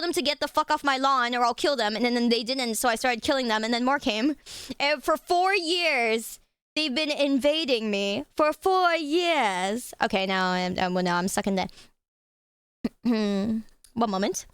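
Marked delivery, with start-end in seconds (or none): 4.8-9.9 slow, stretched mock-epic telling; dramatic drawl. Ranting to friends about the ant invasion, slow and dramatic. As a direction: drag each word like a war story.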